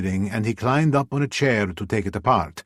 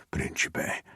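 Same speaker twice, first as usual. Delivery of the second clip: whispering; 4 semitones lower, 6 dB quieter than usual. Acted. Whispered pet name, sleepy.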